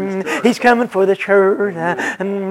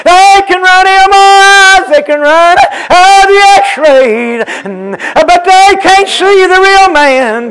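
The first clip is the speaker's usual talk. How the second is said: higher, louder, slowed, sung-out chant; whooped preaching; 8 semitones higher, 5 dB louder than usual. Acted. Preacher telling the sick brother that doctors can't see the real man, whooped. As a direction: ring out each phrase in a preacher's whoop.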